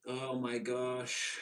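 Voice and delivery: slow, stretched gasp of disbelief; drawn-out oh my gosh. Retold or imitated. Imitating stunned onlookers, a drawn-out gasp. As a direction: let it hang, stunned. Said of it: "everybody is like".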